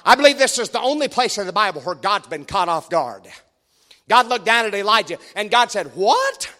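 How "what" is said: sharp high startled what; startled, pitch jumping up; surprised God. Retold or imitated. God caught off guard.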